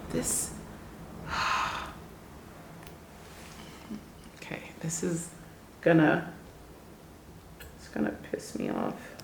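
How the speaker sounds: hushed, barely voiced aside; very soft, broken by sighs and long pauses; holding back upset. Real, not acted. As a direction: sigh it out under your breath, holding irritation in. Composing herself before upsetting story.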